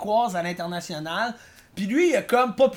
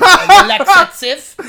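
laughing; 8 semitones higher, 15 dB louder, slower than usual. Real, not acted. Laughing mock-dramatic answer.

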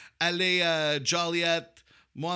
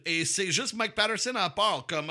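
ordinary talk

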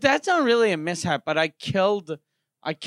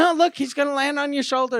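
higher pushy mock voice; insistent girl; 7 semitones higher than usual. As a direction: insist cheerfully. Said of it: "she was like".

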